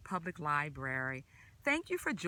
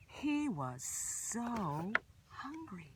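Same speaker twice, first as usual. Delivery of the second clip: whisper; 3 semitones higher, 5 dB quieter, much slower than usual. A narrator's reading. Reading a picture book aloud.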